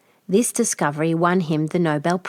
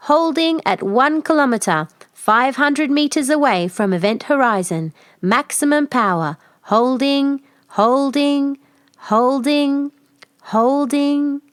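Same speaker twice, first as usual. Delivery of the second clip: steady machine report; ship computer; 7 semitones higher than usual. Acted. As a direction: announce it like the ship's computer.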